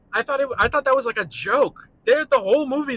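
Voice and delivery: loud, high indignant rant; heated. Real, not acted. Ranting that Funny Face seemed a joke, heated. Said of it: "That really did get me heated up".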